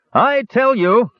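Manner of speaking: high, drawn-out insistence from a tipsy kender; loud tipsy boasting. A narrator's reading. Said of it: "was talking loudly".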